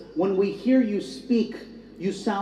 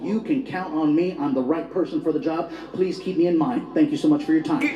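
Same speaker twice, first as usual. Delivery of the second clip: lower, polished interview voice; good job voice; 3 semitones lower than usual. Acted. Applicant selling himself at an interview, good job voice. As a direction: clean it up for the interviewer. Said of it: "trying to get a good job voice".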